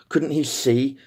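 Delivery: ordinary delivery, nothing marked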